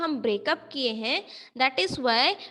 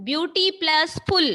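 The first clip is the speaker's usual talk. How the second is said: beauty and full as separate, slow beats; broken-up word; 3 semitones higher, 4 dB louder, much slower than usual. Shown as a demonstration. Teacher showing a class where the word breaks, beauty plus full in parts. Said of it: "we are breaking the word in between".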